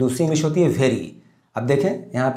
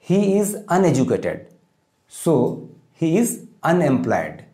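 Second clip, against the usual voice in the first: slow dictation; much slower than usual. Shown as a demonstration. Teacher dictating model sentence.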